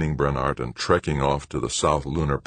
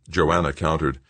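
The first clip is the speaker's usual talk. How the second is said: ordinary delivery, nothing marked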